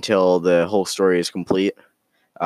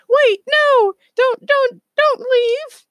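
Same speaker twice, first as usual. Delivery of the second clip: very high, loud squeaky plea; panicked squeal from a tiny creature; Space Squirrel; 6 dB louder, slower than usual. Acted. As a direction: cry out in a squeaky cartoon critter voice. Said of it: "Space Squirrel goes".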